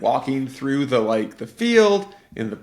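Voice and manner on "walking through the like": normal talking voice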